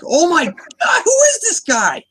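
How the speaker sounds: high, startled cry of disbelief; shocked mob boss. Retold or imitated. Podcaster reenacting Falcone seeing Batman, high shocked cry. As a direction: gasp it out like a gangster caught off guard.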